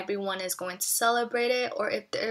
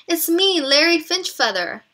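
calling out; 8 semitones higher, 8 dB louder than usual. Acted. Larry calls into bathroom.

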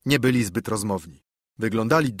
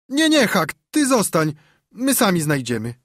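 put-on a much higher, urgent boyish voice; hasty boy; 7 semitones higher, 4 dB louder than usual. The boys hurriedly stop Huck from following, high and urgent. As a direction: wave Huck off quickly. Boys refuse Huck's help.

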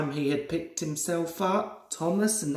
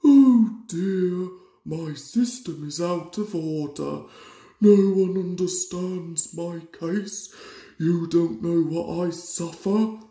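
louder, self-pitying moan of a sulky engine; moaning Henry; 4 dB louder than usual. A narrator's reading. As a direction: whine about your suffering to win sympathy. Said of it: "moaned Henry".

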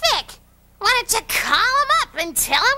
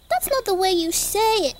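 usual speaking voice

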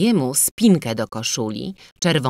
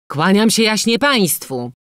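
loud, raised-pitch sarcastic salute; shouted mock greeting; 6 semitones higher, 6 dB louder than usual. A narrator's reading. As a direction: call out a malicious greeting to the gentry. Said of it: "krzyknął i zwrócił się do ludzi". (złośliwe, głośne powitanie)

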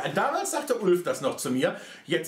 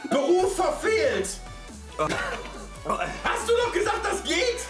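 high, drawn-out outraged cry; high slow outrage; 7 semitones higher, slower than usual. Real, not acted. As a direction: wail in disgusted outrage. Disgusted outburst at the smell.

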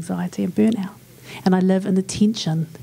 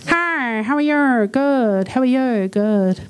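Kiwi small talk; 2 semitones higher, much slower than usual. Shown as a demonstration. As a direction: trade stock Kiwi pleasantries in a stretched sing-song. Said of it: "Here's a real good Kiwi chat".

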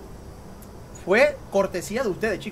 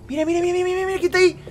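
much higher, rapid startled repeats; 10 semitones higher, faster than usual. Real, not acted. Gamer spotting the neighbor, high startled squeal. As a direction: squeak it out in surprise.

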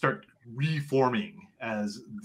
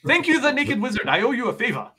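much higher, gushing thanks; grateful alligator voice; 10 semitones higher, 4 dB louder than usual. Acted. Kuya Bawaya thanking the Naked Wizard, high and grateful. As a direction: thank them like a delighted creature. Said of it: "Kuya Bawaya says".

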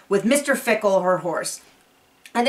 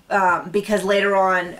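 ordinary talk

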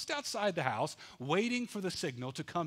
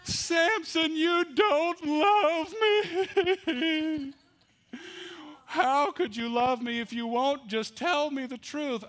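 wailing accusation; 12 semitones higher, 7 dB louder, slower than usual. Acted.

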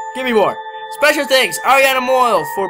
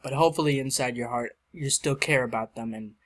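sincere; 8 semitones lower, 7 dB quieter than usual. Real, not acted. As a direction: speak it gently from the heart. Sincere dedication message.

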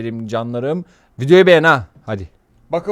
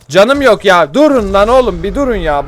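loud, high frantic yelling at the soldiers; frantic shout; 5 semitones higher, 9 dB louder than usual. Real, not acted. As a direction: yell at them to stop shooting. Shot at by soldiers. (askerlere panikle bağırarak)

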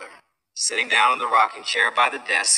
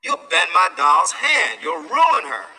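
louder, slow protest; upset sister; 5 semitones higher, 6 dB louder than usual. A narrator's reading. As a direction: sister scolds Sam over her doll. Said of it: "screamed Marcia".

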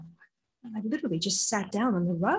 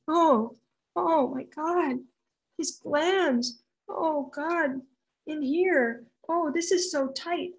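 higher, slow re-enacted discomfort; pained amazement; 6 semitones higher, much slower than usual. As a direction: groan out each discovery in amazed distress. Re-enacts feeling nephew's symptoms.

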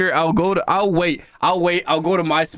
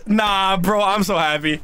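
ordinary talk

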